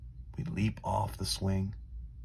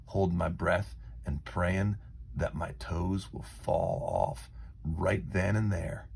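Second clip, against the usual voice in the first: plain reading voice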